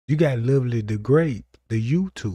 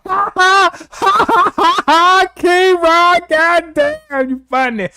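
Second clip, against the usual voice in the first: very high, loud mocking cackle of a laugh; shrieking mock laughter, much higher and louder; exaggerated fake laugh; 5 dB louder than usual. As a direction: ham up a big fake laugh to ridicule him. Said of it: "Let me get mine out".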